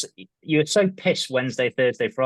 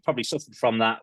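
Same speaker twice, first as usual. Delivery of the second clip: ordinary talk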